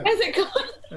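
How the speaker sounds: high laughing question. Real, not acted. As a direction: laugh through the words.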